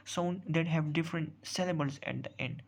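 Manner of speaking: slow, deliberate reading of a definition; teacher dictating a definition slowly. Shown as a demonstration. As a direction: spell the rule out word by word, very slowly. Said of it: "reads definition out slowly".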